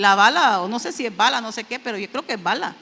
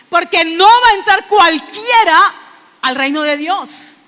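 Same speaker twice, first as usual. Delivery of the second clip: fast, loud, raised preaching voice; fervent preaching; 6 semitones higher, 10 dB louder than usual. Acted. Preacher exhorting the brethren about Enoch, loud and fervent. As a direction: preach it out to the congregation.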